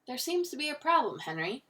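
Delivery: put-on high-pitched, affected English accent; mock-English, starting high and dropping on the name; fake English accent. A narrator's reading. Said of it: "in a fake English accent".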